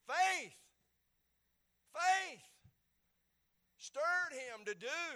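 high, loud faith repeated; shouted faith from the pulpit; preaching shout. Real, not acted. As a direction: ring out faith twice like a preacher. Preacher repeats key word.

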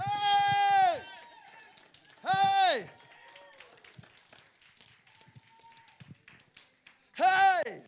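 loud high holler of praise; a preacher's loud joyful hey. Real, not acted. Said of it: "reasons to holler".

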